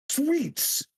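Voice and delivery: high, ringing bardic cry; grand proclamation. Acted. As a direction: announce the word to the audience. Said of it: "grand proclamations".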